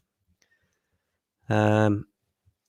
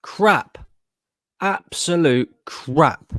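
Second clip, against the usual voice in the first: disgusted; 5 semitones higher, much slower than usual. Real, not acted. Disgust at offside.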